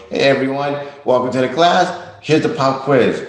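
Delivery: a hammed-up teacher springing a quiz; teacher announcing quiz. Teacher springing a pop quiz on the class, cheery and loud.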